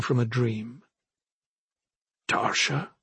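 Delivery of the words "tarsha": hushed, tentative questioning; soft question. A narrator's reading. Brother recognizing his sister as if from a dream, asking softly. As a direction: ask it softly, as if just waking. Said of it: "he asked softly".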